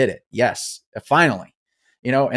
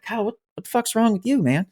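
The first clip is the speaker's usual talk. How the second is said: teammates' alarmed question; 10 semitones higher, faster than usual. Retold or imitated. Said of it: "they're like".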